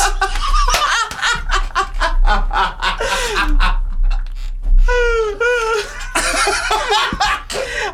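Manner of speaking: long high squealing laugh; helpless howling laughter. Real, not acted. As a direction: laugh until you can't breathe. Hysterical laughter at story.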